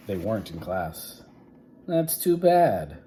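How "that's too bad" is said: corny principal. Acted. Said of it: "said Mister Blansworth".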